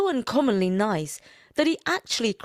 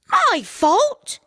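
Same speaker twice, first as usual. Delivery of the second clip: much higher, louder scornful retort; 7 dB louder than usual. A narrator's reading. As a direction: scoff at him like an indignant wife. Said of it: "retorted his wife scornfully".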